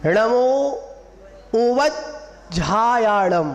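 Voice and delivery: उवज्झायाड़म very slow, split after उवज्, long झा; firm णमो, pause, then every syllable of उवज्झायाड़म; clear full pronunciation. Shown as a demonstration. Teacher modelling the mantra line, slow and clear उवज्झायाड़म. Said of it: "पूरा शब्द clear होना चाहिए".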